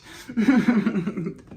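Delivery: short amused laugh. Real, not acted. Talking of sounding foreign, amused laugh. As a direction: burst out in a loud chuckle. Amused by her own channeling.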